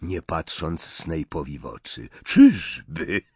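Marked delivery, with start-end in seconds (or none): 2.2-3.2 scornful, louder. Acted. Snape scorns Wormtail.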